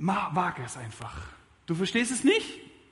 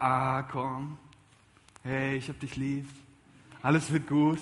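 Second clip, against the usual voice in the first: soothing comfort; 4 semitones lower than usual. Retold or imitated. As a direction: coo it like a gentle consoler. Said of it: "wie wir es uns manchmal wünschen". (ein Hauch sanfter Trost)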